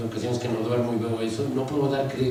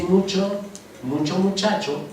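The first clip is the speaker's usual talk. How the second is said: mucho muchacho in a Mexico City accent, voice a bit higher; Chilango accent; 7 semitones higher than usual. Shown as a demonstration. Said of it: "un chilango dice".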